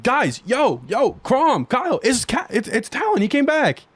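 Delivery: high, eager call to friends; excited. Pretend. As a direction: burst in thrilled to bring news. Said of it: "I'm gonna walk in and be like".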